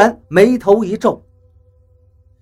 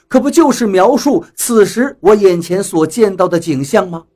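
plain reading voice

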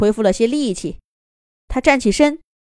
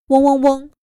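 buzzing fly sound; 6 semitones higher, 5 dB louder than usual. A narrator's reading. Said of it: "小蟋蟀和小苍蝇嘤嘤嘤".

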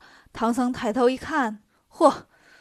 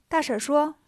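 ordinary delivery, nothing marked

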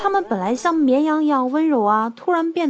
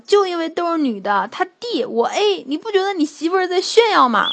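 mock-angry outburst, pitched higher; indignant retort; 6 semitones higher than usual. Angry little brother confronts his brother over the sister-in-law, indignant. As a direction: fume in mock outrage, voice climbing. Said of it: "二呆就怒了".

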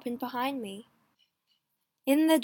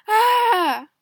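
loud, high scream; terrified scream; 10 semitones higher, 10 dB louder than usual. A narrator's reading. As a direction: scream as you are dragged off. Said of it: "I could hear David Riccio's screams".

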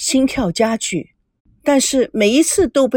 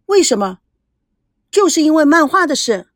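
surprised; 5 semitones higher, 3 dB louder than usual. A narrator's reading. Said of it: "孙娜惊讶".

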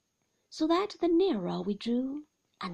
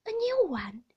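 nurse announcing; 9 semitones higher, 4 dB louder than usual. A narrator's reading. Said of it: "said Peggotty".

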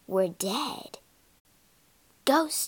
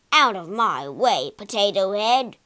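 loud, lower shout; rude shout; 3 semitones lower, 6 dB louder than usual. Acted. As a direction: shove past the ghost with a bellow. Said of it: "he shouted".

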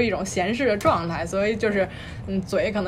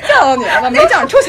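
blunt Beijing nurse; 3 semitones higher, 8 dB louder, faster than usual. Retold or imitated. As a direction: shoo the patient out loudly. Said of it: "那你们怎么说话".

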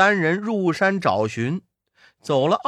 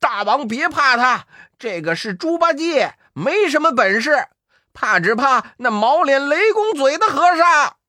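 小妖拔高嗓门喊话; 回头冲大王高喊; 8 semitones higher, 5 dB louder than usual. Acted. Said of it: "高叫". (高声喊)

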